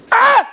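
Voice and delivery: high, loud startled scream; a sudden fright cry; short scream. A narrator's reading. Couple seeing a bloody face at the window, high loud scream.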